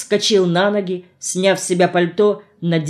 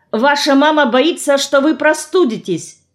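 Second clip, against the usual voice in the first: high, urgent raised voice; yelling; 8 semitones higher than usual. A narrator's reading. Girl warns him his mother is worried, yelling. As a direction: keep calling out, pitched well up. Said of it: "заорала я".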